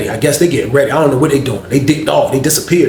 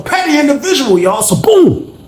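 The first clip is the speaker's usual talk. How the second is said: high, playful admission; playful confession; 11 semitones higher than usual. Real, not acted. Telling viewers he was petty, playfully high. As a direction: pitch up for the punchline.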